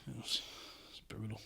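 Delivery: breathy, hushed aside; whisper. Real, not acted. Wrapping up the drinking story to the co-host, a hushed whisper. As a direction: drop to a whisper to close the story.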